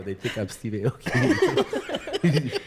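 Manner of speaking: drawn out through laughter; laughing, slow. Retold or imitated. To the gate guy, laughing slowly through it. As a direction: stretch it slowly while cracking up.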